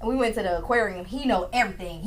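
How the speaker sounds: usual speaking voice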